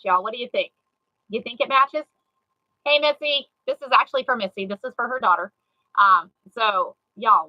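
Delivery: ordinary talk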